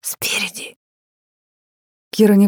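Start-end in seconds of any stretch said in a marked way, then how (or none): 0.0-0.8 creepy whispered hint; sinister whisper. A narrator's reading. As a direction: whisper it ominously. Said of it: "зловещим шёпотом".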